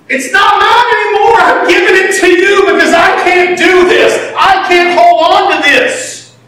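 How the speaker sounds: high, loud pulpit preaching; preaching at full force. Acted. Pastor preaching hard to the congregation, high and loud.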